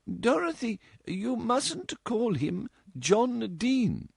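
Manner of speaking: high, slow, gently scolding mother; reproving mother. A narrator's reading. Mother corrects her daughter's manners, gently reproving. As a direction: correct the girl like a proper mother.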